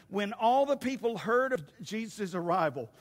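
ordinary delivery

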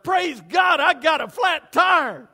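fake cheerful. Always-happy person shouting praise about a flat tire. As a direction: overdo the delight at bad news. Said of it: "so happy all the time".

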